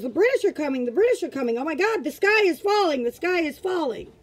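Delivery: mock panic. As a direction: cry the warning like the world is ending. Mimicking panicked alarm cries.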